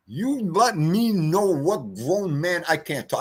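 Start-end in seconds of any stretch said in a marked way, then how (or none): none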